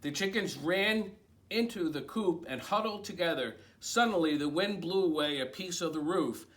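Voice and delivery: lively picture-book read-aloud; reading to children. A narrator's reading. Librarian reading a picture book to children, animated. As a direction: read it like bedtime story time.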